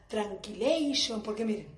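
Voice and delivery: usual speaking voice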